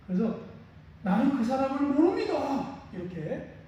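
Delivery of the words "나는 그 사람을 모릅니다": higher, tense voice of a man denying a friend; Peter's fearful denial. Acted. Peter afraid for his life, denying Jesus. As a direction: deny it as if your life depends on it.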